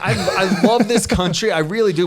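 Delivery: says it through a laugh, slow and loud; laughing while declaring love for the country. Real, not acted. Laughing as she tells her friend she loves America.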